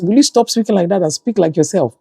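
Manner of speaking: mock-exasperated plea to a child; exasperated telling-off. Mother retelling scolding of daughter.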